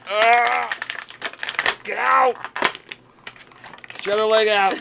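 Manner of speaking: tug at a stuck toy leg; straining exclamations. Real, not acted. Struggling with toy.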